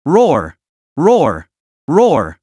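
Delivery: three bellowing beast roars in a row; fierce roars. A narrator's reading. The Minotaur roars from the projection screen, fierce roars. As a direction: roar again and again like an enraged bull. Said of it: "a series of fierce roars".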